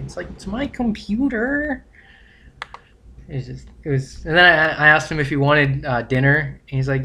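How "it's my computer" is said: high, slow whiny protest; mock whine. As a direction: drag it out like a sulky kid. Teasing whine over the computer.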